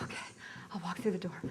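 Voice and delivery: hushed, unvoiced okay, muttered under breath; whispered compliance. Retelling the film shoot to an audience, whispered. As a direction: whisper it like you're obeying a director.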